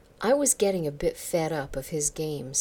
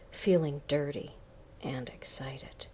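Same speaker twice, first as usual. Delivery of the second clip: plain reading voice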